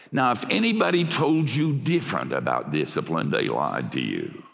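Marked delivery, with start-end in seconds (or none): none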